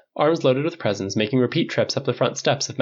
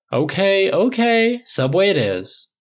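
Tony higher and louder, caving; okay okay pitched way up, then a slower give-in; giving in; 9 semitones higher, 3 dB louder, slower than usual. A narrator's reading.

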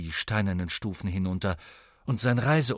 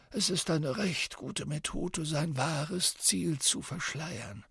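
put-on old wizard voice; 10 semitones higher than usual. A narrator's reading. Dumbledore voiced in story.

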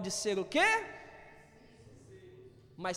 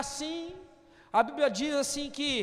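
normal talking voice

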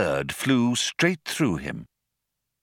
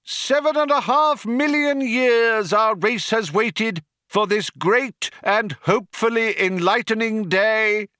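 rally the crowd over a tannoy; crying out to crowd; 11 semitones higher, 3 dB louder than usual. A narrator's reading. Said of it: "cried the cheerleader".

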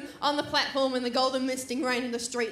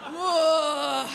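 normal talking voice

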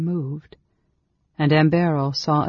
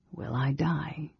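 slow, much softer question; quiet fearful question; 8 dB quieter, slower than usual. A narrator's reading. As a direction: ask it slowly in dread. Fearful sick mage.

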